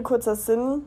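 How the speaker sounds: ordinary talk, nothing marked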